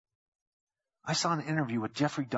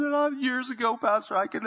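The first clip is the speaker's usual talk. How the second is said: weeping woman; 11 semitones higher, 3 dB louder than usual. Retold or imitated. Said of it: "a woman that was just weeping and sobbing".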